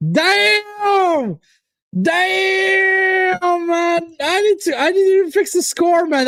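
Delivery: high, buzzing, can't sit still; excited. Real, not acted. Fan too hyped to fix the scoreline, excited. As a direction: ride the rush of joy. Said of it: "That's how excited I am".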